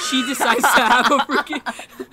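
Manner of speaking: loud, high words breaking into laughter; bursting into laughter. Real, not acted. Telling how she froze on the ladder, bursting into laughter. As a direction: blurt it out through real laughter.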